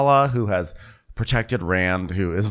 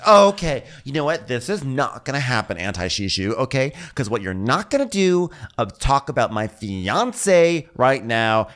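mock Lala tirade; 5 semitones higher than usual. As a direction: lecture him, outraged, slowing for emphasis. Voicing Lala's angry tirade.

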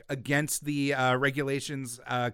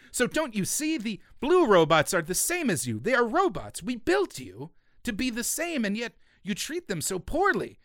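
lightly voiced preachy astronaut; 7 semitones higher than usual. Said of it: "And they're like".

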